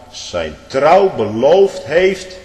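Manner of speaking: very slow, loud and high on "trouw beloofd"; preaching at full force. Acted. Preacher pressing marriage vows on the congregation, slow and loud. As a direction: stretch it out like a pulpit climax.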